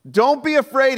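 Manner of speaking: loud, high call over the storm; reassuring shout across the water. Acted. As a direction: call out to calm frightened men. Jesus calling over the storm.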